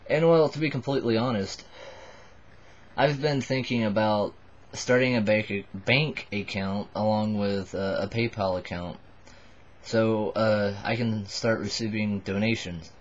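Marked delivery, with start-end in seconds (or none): none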